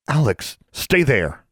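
high, loud sharp order; barked command. A narrator's reading. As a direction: bark it so everyone freezes. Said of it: "The bark of her command".

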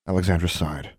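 ordinary delivery, nothing marked

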